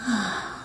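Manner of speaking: long, soft sighing out-breath; audible exhale. Shown as a demonstration. Guide showing listeners how to exhale, soft sigh. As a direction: let the breath go softly through the mouth. Demonstrates exhaling through the mouth.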